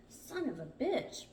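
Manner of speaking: high, slow exasperated curse; frustrated. A narrator's reading. Brad straining at a stuck plug, frustrated.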